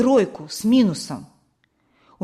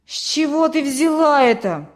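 loud, higher-pitched retort; indignant, raised-voice snap; snapping back; 5 semitones higher, 5 dB louder than usual. A narrator's reading. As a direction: snap back defensively as an accused boy. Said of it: "огрызнулся Петька".

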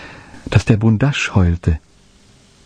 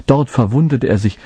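ordinary delivery

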